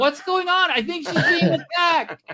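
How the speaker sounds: very high mock-frantic voice; hammed-up high-pitched panic; panicked mom. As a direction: wail it like an alarmed mother. Plays mom overhearing the call.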